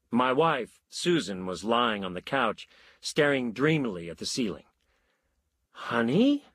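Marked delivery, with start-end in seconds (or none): 5.7-6.4 tentative. A narrator's reading. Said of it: "I said tentatively".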